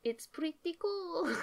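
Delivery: high, slow put-on voice; silly impression voice. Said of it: "that was my gesture impression".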